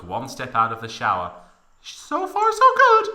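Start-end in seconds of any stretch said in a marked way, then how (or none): 1.8-3.1 very high squeaky self-talk; falsetto, not whispered. Acted. Tifa talking to herself, high falsetto. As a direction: squeak it to yourself. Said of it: "whispered Tifa to herself".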